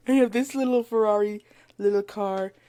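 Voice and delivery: ordinary talk, nothing marked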